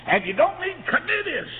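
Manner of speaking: high and loud, slowing at the end; preaching climax. Acted. Preacher telling church it needs no committees, shouting. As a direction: drive the point home in a shout.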